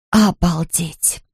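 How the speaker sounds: slow, stunned wonder; amazed. A narrator's reading. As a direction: draw it out, understated astonishment. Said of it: "удивлялась она".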